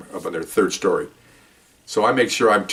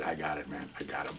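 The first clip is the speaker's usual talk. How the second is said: hushed report; 6 semitones lower, 18 dB quieter than usual. Retold or imitated. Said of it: "I said".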